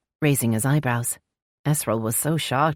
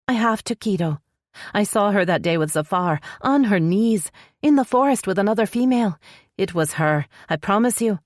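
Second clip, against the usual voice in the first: higher female voice, frustrated and accusing; higher voice pressing her point; Esrel, frustrated sister; 6 semitones higher than usual. A narrator's reading.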